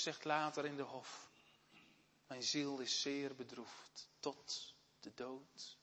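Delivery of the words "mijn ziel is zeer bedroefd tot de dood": Jesus in the garden, crushed by grief. Acted. (diep bedroefd en zacht)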